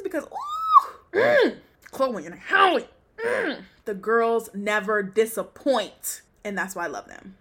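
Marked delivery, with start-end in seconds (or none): 0.0-3.6 high, loud squeal of delight; gushing excitement. Real, not acted. Fan raves about [name] and [name]'s performance, gushing squeal. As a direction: squeal with relish over favourite singers.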